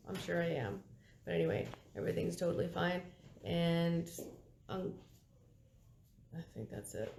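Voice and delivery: rough, gravelly voice after anesthesia; hoarse and gravelly from the tube. Real, not acted. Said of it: "and I sound gra-, gravelly".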